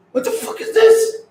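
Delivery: high squeal of disgust; disgusted shriek. Real, not acted. Streamer shrieking at ugly toes on camera, disgusted. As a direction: shriek it out, grossed out.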